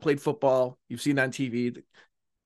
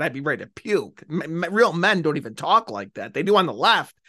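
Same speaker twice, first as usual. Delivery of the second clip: usual speaking voice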